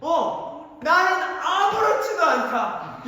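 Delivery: mock defiant. Acting defiant kid's reply.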